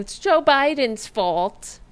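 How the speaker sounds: loud, high, drawn-out blame; mock Republican whining. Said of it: "I sound like a Republican when I".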